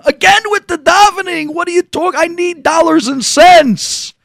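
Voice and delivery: high, loud, furious outburst; exasperated shouting. Acted. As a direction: shout Dad down, fed up. Son explodes at father over tuition.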